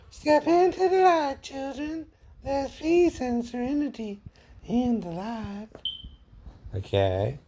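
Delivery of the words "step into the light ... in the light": Zelda from Poltergeist. Retold or imitated. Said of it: "I can even do Zelda from Poltergeist".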